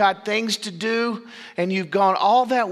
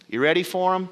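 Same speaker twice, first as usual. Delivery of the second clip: usual speaking voice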